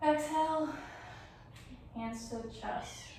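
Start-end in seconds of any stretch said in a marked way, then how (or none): none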